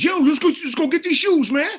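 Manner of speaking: high, loud mock urging; imitated excited sidekick. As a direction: play the hyped-up buddy egging him on. Acts out the rapper's excited friend.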